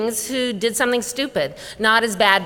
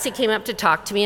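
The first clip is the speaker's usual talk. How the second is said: ordinary talk, nothing marked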